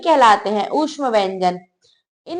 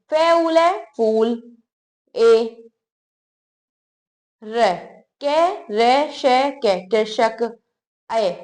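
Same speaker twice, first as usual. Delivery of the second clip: slow spelling out; 3 semitones lower, much slower than usual. Shown as a demonstration. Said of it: "आ की मात्रा दिखाई दे रही है आपको".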